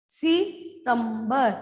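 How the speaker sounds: very slow सितंबर, split as सि, तंबर; syllable by syllable. Shown as a demonstration. Teacher sounding out the spelling, very slow September. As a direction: break September after सि and stretch it. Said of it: "म आधा".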